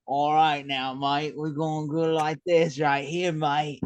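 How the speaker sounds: slurred, drawn-out British mate-speak; slangy British accent. Retold or imitated. Imitating a royal family sketch, lazy British accent. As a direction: drop proper English for loose British slang. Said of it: "like, really proper English, and then whenever they walk away".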